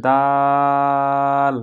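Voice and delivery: দাল held very long, slow chant; drawn-out Dal. Shown as a demonstration. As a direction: stretch দাল slowly and evenly. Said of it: "এই দাল বলার সময়".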